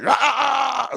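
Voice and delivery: high, loud drawn-out "aah" yell; mock a friend hollering down the phone; imitated yelling. Host mimics his friend yelling on the phone, loud yell. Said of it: "He was yelling at me".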